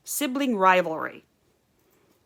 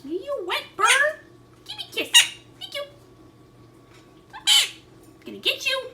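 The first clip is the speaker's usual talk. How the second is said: talking to a bird. Real, not acted. High-pitched talk to pet birds.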